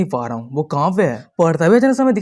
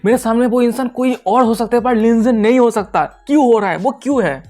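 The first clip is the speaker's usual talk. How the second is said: high-pitched, mildly raised voice of disbelief; storyteller's mock shout, pitched up; narrated shout; 6 semitones higher than usual. A narrator's reading. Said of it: "चिल्लाते हुए कह रहा था".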